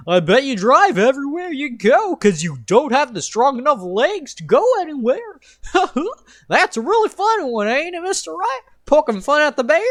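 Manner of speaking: loud goofy clown voice; silly clown. Acted. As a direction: tease him like a cackling clown. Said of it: "your clown voice".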